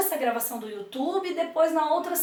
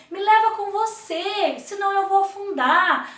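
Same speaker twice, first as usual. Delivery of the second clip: higher pleading plea for Love; 6 semitones higher than usual. Acted. Love begging Joy for a ride, pleading. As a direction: beg not to sink. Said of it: "e o amor falando".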